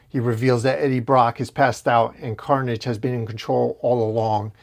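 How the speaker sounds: ordinary talk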